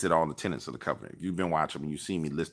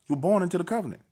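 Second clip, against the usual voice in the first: emphatic repeat; 11 semitones higher, faster than usual. Retold or imitated. Arguing birthright with his cousin, raised emphatic repeat. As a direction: hammer the point home. Driving home point to cousin.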